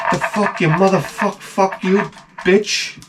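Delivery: high, envious mock curses; jealous cursing. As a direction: spit out envy at the lucky kid.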